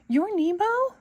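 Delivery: overjoyed disbelief, voice leaping up; Dory thrilled to finally meet Nemo; joyful surprise. A narrator's reading. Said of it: "Dory cried".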